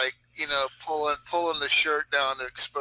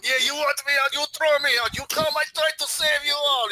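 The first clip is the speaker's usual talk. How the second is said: high, louder, defiant street ranting; crazy gangster rant; 12 semitones higher, 4 dB louder than usual. Acted. Player in gangster mode daring guards to grab him, ranting high and loud. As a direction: challenge them like an unhinged street tough. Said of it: "go into gangster mode".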